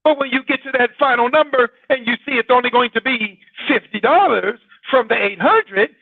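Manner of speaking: normal talking voice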